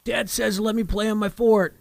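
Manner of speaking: high-pitched mock call to a sibling; imitated kid calling out. Dad retelling his son tattling to sister, imitated calling out.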